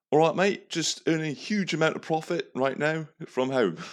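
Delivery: high, loud Cockney attempt drifting Australian; bad London accent. Comedian voices a London Bitcoin trader's message, in a failed London accent. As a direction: have a badly faked go at a London accent. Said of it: "I can't do a London accent, but I'll try, okay?".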